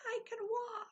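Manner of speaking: joyful yell. Pretend. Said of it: "I wanna yell it".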